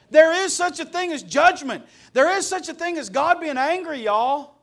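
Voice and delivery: loud, high, impassioned preacher; forceful, ringing sermon warning=; full-force preaching. Real, not acted. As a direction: drive each phrase home to the congregation.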